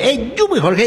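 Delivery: high-pitched cheerful shout of the name; excited call. Real, not acted. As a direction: call out to Jorge, pitch jumping way up. Announcer calls out to Jorge.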